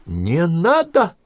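high, drawn-out squeal; shriek. A narrator's reading. Prokhorova squealing at the scouts not to lift her, high. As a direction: squeal it out in protest. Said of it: "взвизгнула Прохорова".

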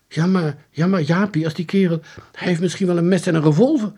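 high, loud, hesitant worry; anxious objection. A narrator's reading. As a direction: stammer out the objection, then hurry. (angstig, gejaagd tegensputteren)

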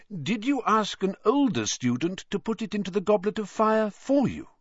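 high, gentle questioning; kindly probing old wizard; lightly voiced questioning headmaster; Dumbledore asking. A narrator's reading.

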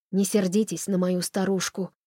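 ordinary delivery

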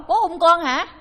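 high, loud puzzled question; mock baffled son checking the words; imitated mishearing. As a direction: ask back loudly as if you misheard. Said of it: "Nó nghe không rõ".

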